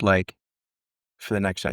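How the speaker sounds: usual speaking voice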